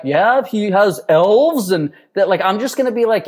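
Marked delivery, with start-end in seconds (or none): 0.0-1.7 slow, higher, hammed-up storybook voice; mock fairy-tale dad. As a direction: drag it out like a parent spinning a tale. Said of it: "I'm also not gonna be like".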